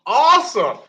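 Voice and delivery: high, loud, fake-bright cool and awesome; sarcastic cheer. Wrestler mocking the cop's brush-off, sarcastic high cheer. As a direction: oversell it, mock delight.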